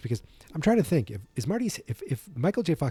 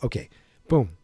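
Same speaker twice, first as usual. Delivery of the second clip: ordinary talk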